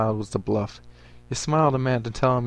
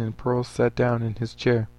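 ordinary delivery

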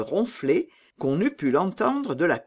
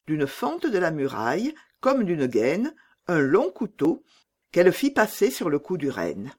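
ordinary delivery, nothing marked

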